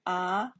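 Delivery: "ah" rising at the end; second-tone "ah", sliding upward. Shown as a demonstration. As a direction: lift the pitch through "ah". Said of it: "Second one".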